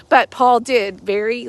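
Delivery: normal talking voice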